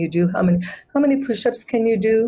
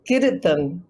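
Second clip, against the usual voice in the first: "Gir" weighted, "tan" light after it; stress on first syllable. Shown as a demonstration. Teacher models "Gir tan" with the first syllable stressed. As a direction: lean on "Gir", let "tan" fall away. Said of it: "putting the frequency on the first syllable".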